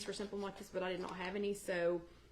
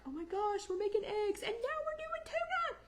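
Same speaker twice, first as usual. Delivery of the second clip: very high-pitched mock crying dog voice; put-on high, excited pet voice; whining pet voice; 14 semitones higher than usual. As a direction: squeal it as the overwrought pet, climbing higher to the end. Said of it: "y'all see why he's crying now? Because he's like".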